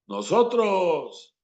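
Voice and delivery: pompous politician speech, one word at a time. Shown as a demonstration. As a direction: drag out each word like an old politician. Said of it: "hablaban palabra por palabra".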